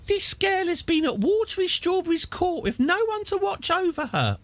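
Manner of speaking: very high put-on character voice. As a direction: squeak it out as the old lady. Voicing a character's event text.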